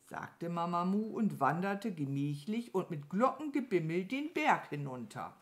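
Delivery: usual speaking voice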